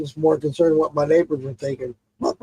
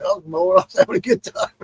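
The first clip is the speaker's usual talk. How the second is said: laughing; 12 semitones higher, 4 dB quieter than usual. Real, not acted. Laughs about mowing in the rain.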